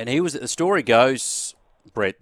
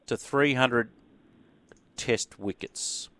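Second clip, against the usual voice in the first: slow quiz reveal; 2 semitones higher, 4 dB quieter, much slower than usual. Acted. Quiz host repeats question.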